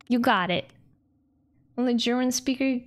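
ordinary delivery

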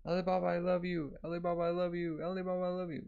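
put-on high lovestruck girl chant; imagined love confession. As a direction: repeat it in a high, dreamy character voice. Imagined confession repeated in his head.

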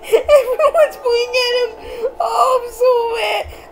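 high squealing laugh through words; laughing hard. Real, not acted. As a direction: cackle while talking, barely able to speak. Laughing at the vote.

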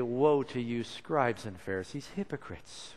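mournful lament, sinking at the end; Jesus grieving over them; tears in his voice. Shown as a demonstration. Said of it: "with tears in his voice".

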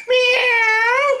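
high mocking kitten meow. Fan mocking the Panthers as kittens. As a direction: meow like a tiny kitty.

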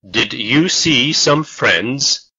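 ordinary talk

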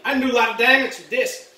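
ordinary talk, nothing marked

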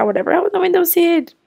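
ordinary delivery

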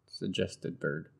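usual speaking voice

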